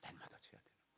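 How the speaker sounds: whispered. Real, not acted. Preacher drops to whisper.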